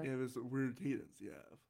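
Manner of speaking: soft low register; low, quiet demo voice; speaking down low. Shown as a demonstration. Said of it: "trying to speak down low here".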